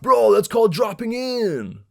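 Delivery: high, loud, slow surfer scolding; pissed surfer. Retold or imitated. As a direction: lecture him like an angry local. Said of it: "He's all pissed".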